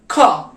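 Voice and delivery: खा from the throat, kh and h blended; guttural kha. Shown as a demonstration. Teacher demonstrating German throat sound खा. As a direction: say खा deep in the throat. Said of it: "उसकी sound German में क्या बोली जाती है".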